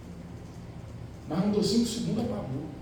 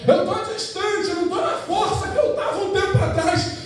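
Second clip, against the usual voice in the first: high, pleading preacher's re-enactment; distant believer confessing; 10 semitones higher than usual. Acted. Distant believer confessing lost strength to Jesus, high pleading cry. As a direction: plead it much higher, stretching the words.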